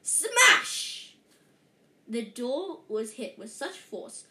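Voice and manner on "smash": high, loud crash sound; loud smash. A narrator's reading. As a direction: yell the smash like impact. Door smashed in.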